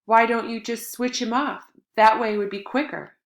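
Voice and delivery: put-on boy yelling at his mother on the phone; angry yell. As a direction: snap it into the phone in bitter fury. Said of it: "I yelled into the receiver".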